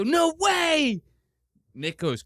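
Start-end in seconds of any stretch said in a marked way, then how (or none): 0.0-1.0 put-on high, loud, thrilled kid shout; mock kid delight. Host imitating a thrilled child hearing about McDonald's, high shout. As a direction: yelp it like a five-year-old getting McDonald's. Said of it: "They go".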